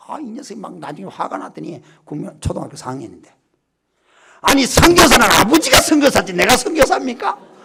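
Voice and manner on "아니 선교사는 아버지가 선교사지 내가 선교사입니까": loud, higher indignant retort; a boy's loud indignant talk-back; son protesting. Retold or imitated. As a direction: argue back at your father, offended. 나중에 막 따지는 거예요.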